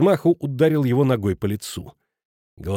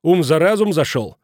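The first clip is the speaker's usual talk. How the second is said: higher, louder nervous retort; raised voice; 5 semitones higher, 5 dB louder than usual. A narrator's reading. Futaki nervously answering back at Schmidt, raised voice. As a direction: raise your voice in agitation. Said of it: "Футаки тоже повысил тон и нервно заёрзал".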